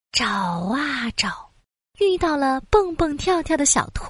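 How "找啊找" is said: drawn-out, slow refrain; slow searching. A narrator's reading. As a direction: stretch it out, searching. Refrain said slowly.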